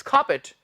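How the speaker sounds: खापेट as aspirated KHAA-pit, silent R; correct pronunciation. Shown as a demonstration. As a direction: puff the K in खापेट, short pit ending. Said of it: "पर बोलेंगे क्या?".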